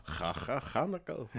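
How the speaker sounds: "Ha Ha Hanukkah" with the H scraped in the throat; exaggerated throaty Hanukkah. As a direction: draw out each "Ha" as a throat-clearing kh before "Hanukkah". Joking exaggerated throaty Hanukkah greeting.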